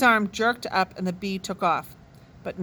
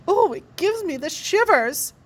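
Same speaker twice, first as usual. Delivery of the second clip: put-on high, swooning girlish voice; gushing girl; 8 semitones higher, 3 dB louder than usual. A narrator's reading. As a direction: squeal it like a smitten girl. Judy gushing over sting.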